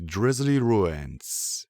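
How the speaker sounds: ordinary delivery, nothing marked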